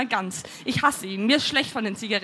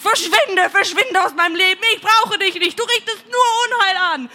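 loud, raised furious shouting; bellowed; 6 semitones higher, 6 dB louder than usual. A narrator's reading. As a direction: drive him out, climbing higher to the end. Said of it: "brülle ich". (wütend brüllend)

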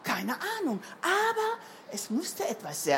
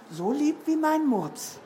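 ordinary delivery

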